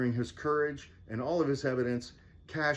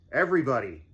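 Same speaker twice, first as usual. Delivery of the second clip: calling out; 5 dB louder, faster than usual. Acted. Cash addresses everybody.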